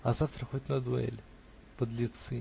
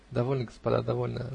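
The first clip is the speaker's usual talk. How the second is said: plain reading voice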